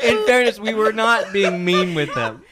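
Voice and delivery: loud, high laughing protest. Real, not acted. Laughing defence.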